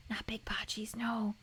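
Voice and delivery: unvoiced hushed whisper. Real, not acted. Talking to a friend in a hushed whisper. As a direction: whisper it aside.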